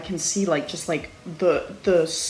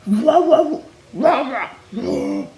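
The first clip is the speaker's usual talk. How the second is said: mock growl; 6 semitones higher, 6 dB louder, slower than usual. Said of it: "She's like".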